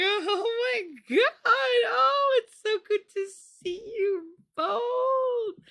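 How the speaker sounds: high, slow, loud fake delight; voice goes really high. Greeting parents of an ugly baby, high slow squeal. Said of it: "my voice goes really, really high".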